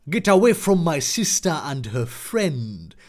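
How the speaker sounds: put-on low, lightly done Jamaican accent; a hint of a Jamaican accent. Brother Josh defending his sister, mild Jamaican accent. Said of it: "racist Jamaican accent".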